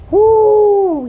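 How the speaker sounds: loud, high, long-held "woo"; elated whoop. Real, not acted. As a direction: whoop with joy over the verse. Exclaims over the verse.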